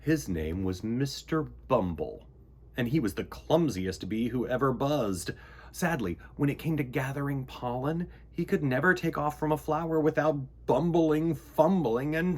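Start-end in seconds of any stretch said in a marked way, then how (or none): none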